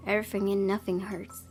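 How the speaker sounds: normal talking voice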